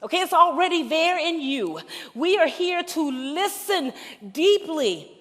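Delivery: building sermon climax; preaching at full force. Acted. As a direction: lift the room with conviction.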